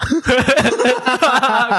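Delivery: burst of laughter. Real, not acted. Reacting to mock game setup.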